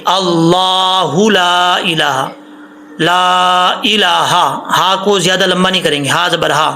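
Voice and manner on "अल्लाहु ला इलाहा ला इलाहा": अल्लाहु with a full, heavy L, sung slowly; heavy Allahu. Shown as a demonstration. Teacher showing students the heavy L in अल्लाहु, chanted slowly. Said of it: "इसको मोटा पढ़ेंगे".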